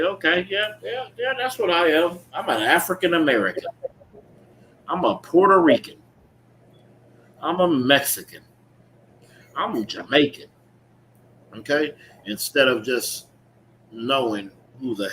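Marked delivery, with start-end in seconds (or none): 0.0-10.5 mock compliance. Mocking people accepting labels.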